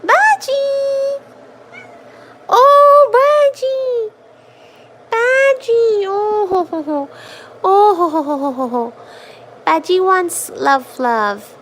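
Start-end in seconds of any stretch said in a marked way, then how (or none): 0.0-6.0 very high, loud calls of the cat's name; high, loud thrilled calling to a cat; excited calls. Real, not acted. 6.0-8.9 cooing oh ho ho. Real, not acted. Cooing laugh at cat.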